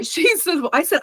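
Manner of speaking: usual speaking voice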